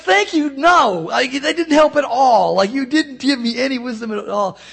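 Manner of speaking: loud, high, exasperated protest; exasperated retort. Retold or imitated. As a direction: throw up your hands, it didn't help at all. Rick's frustrated retort.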